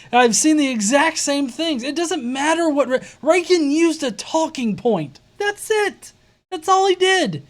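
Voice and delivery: high-pitched, insistent protest; emphatic, exasperated rebuttal; exasperated debunking. Real, not acted. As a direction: argue it down point by point, fed up.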